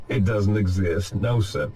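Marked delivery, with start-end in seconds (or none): none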